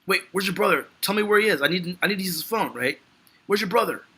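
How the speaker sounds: high, urgent demanding; frantic Dan Fielding. Retold or imitated. Desperate man demanding where the brother is, high and urgent. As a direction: press him hard for the brother. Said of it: "Dan Fielding is like".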